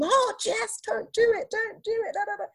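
very high mock pleading; retold team's high, fretful protest; pleading colleagues. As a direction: beg in a squeaky imitated voice. Mimics team pleading.

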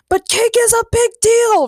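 high, drawn-out yell; shouted. A narrator's reading. Ray objects to talk of other matters, shouting. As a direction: shout it as passionate protest. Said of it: "Ray shouted".

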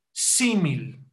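"Símil" stressed on the first i, falling after; stress on first syllable. Shown as a demonstration. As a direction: say "Símil" slowly and clearly, accent on SÍ. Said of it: "Lleva acento en la primera I". ("Símil" con acento en la primera i)

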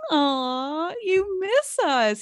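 high, slow cooing tease; teasing. Pretend. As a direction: coo it like to a pet. Teasing absent listener.

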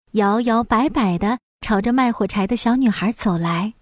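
ordinary delivery, nothing marked

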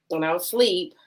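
usual speaking voice

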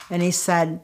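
normal talking voice

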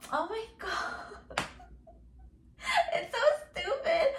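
high, squeaky real laugh through the words; laughing in disbelief. Real, not acted. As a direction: break up laughing as you speak. Cracks up at the comment.

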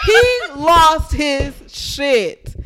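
loud, drawn-out declaration; dramatic emphasis. Real, not acted. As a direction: stretch every word out. Dramatic gossip punchline.

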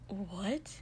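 quiet, pointed question; sharply. A narrator's reading. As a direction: ask it tersely, rising at the end. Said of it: "Shoto said sharply".

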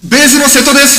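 over-the-top high-pitched yell; shouted introduction. Pretend. Bassist bursts in after the drummer's calm introduction, shouting. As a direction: belt out the self-introduction, high and very loud.

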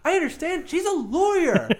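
high, exasperated protest. Real, not acted. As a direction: push back in disbelief. Exasperated rebuttal.

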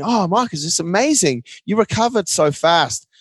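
a hint of a gushing fan; mock polite admirer. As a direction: put on a chirpy admirer's praise. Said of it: "people will say to me".

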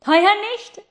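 high, loud call; boy calling down to the girl, high and loud. Acted. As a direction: shout it down to her. Boy calls down from above.

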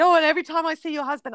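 mild mock of a friend's joke; imitating joking friend. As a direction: rattle it off like a tipsy pal teasing. Friend joking after drinks.

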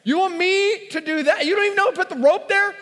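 high, fast, incredulous protest; incredulous panic. Retold or imitated. Arguing with his friend on the ledge, high and fast in panic. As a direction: voice shooting up in alarmed disbelief.

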